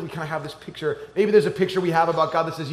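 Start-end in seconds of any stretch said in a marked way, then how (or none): none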